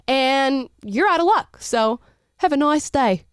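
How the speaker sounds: put-on breezy brush-off in an Australian accent. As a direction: slip into an Australian accent, drawing it out. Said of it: "in an Australian accent".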